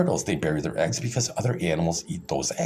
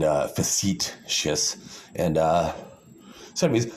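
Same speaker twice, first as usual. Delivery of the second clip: usual speaking voice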